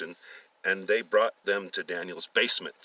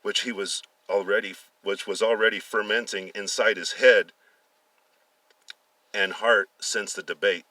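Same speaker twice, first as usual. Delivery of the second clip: ordinary delivery, nothing marked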